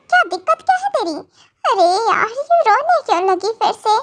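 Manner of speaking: high, rushed exasperated demand; fed up, quick and high-pitched. Acted. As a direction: demand it fast, pitch jumping up in frustration. Fed up with crying baby.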